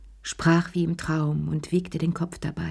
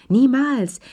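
higher, louder sharp refusal; emphatic retort; 7 semitones higher, 5 dB louder than usual. A narrator's reading. Lea snapping back at Madame Pelou, emphatic and louder. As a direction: fling the word back at her.

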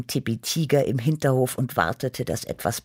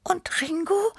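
very high little kitty voice; high-voiced cat with a rising question. A narrator's reading. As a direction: ask in a high, small squeak. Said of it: "fragte Tippi".

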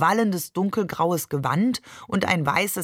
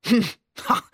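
brief hummed mock chuckle; rumbling laugh; 5 semitones higher than usual. A narrator's reading. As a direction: hum a short grumbly laugh. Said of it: "lachte brummend".

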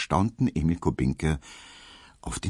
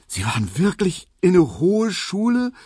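high, loud, incredulous question; astonished disbelief; 14 semitones higher, 5 dB louder than usual. A narrator's reading. Hedwig hears of her suitor's schooling, astonished and incredulous. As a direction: ask it amazed, voice pitched right up. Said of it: "fragte Hedwig erstaunt und ungläubig".

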